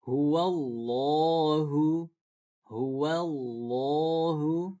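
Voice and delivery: "Huwallahu" very slow, the lla thick, read as "lo"; heavy lam in the name Allah. Shown as a demonstration. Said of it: "Itu dibaca tebal".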